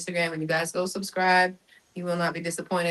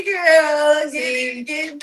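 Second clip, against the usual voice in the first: high, loud excited exclamation; 9 semitones higher, 5 dB louder than usual. Real, not acted. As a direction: hype her up. Hyping up coworker Ashley.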